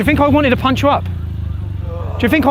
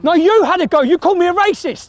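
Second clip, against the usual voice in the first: raised, accusing voice; louder and higher, jabbing back; angry; 6 semitones higher, 4 dB louder than usual. Real, not acted. To the cyclist in the car park, raised accusing voice.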